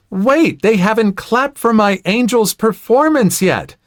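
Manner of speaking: high-pitched outraged entitled mother; indignant mock yell. Entitled mother demands applause for her daughter, high indignant mock yell. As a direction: call out in a high, put-on protest. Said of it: "Entitled mother yells out".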